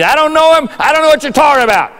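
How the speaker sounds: high, loud shouted denial; angry denial. Acted. Peter denying Jesus with an oath, angry shout.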